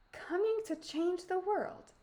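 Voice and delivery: put-on high, musing old-woman voice; high, hushed echo of what was heard; muttering old woman. A narrator's reading. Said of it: "she muttered".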